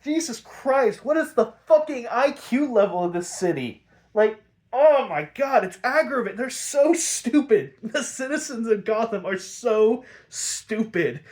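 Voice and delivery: high, loud exasperation; exasperated rant. Real, not acted. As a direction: rant in real disbelief at their stupidity. Reviewer ranting at stupid citizens.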